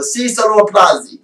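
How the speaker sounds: a light pretend-Italian reading of "Si sono applausi"; faking Italian. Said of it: "I fake the Italian in that".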